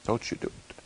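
breathy, almost whispered warning; hushed plea. Retold or imitated. Friend begging Gunnar not to race, whispered. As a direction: beg him under your breath.

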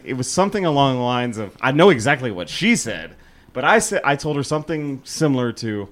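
ordinary delivery

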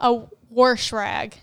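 warsh rag said slowly, with an R in wash; slow, rural warsh rag; regional warsh. Retold or imitated. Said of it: "how they say".

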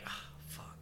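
breathed out, almost voiceless; very soft whispered groan of giving in; resigned sigh. Retold or imitated. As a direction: sigh it out in retold defeat. Retold giving in to a beer.

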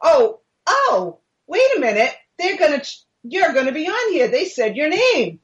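high, singsong imitation of a husband; husband's alarmed call. Husband calling to his wife about the TV show, high mock alarm. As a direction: mimic him calling out, pitch swooping up. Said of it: "I hear him say".

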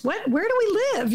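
a sudden high burst of disbelief; incredulous. Real, not acted. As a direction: throw the question up in disbelief. Exasperated rhetorical outburst.

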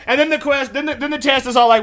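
ordinary delivery

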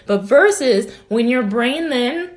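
ordinary talk, nothing marked

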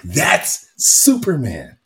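slow, drawn-out awe; awed. Retold or imitated. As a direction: savor the words in wonder. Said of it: "And I'm like".

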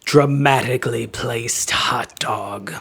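a hushed, slow, dramatic whisper. Pretend. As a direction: breathe the name out like a secret. Reading patron names in voices.

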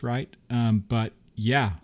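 ordinary talk, nothing marked

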